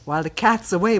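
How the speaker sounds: ordinary talk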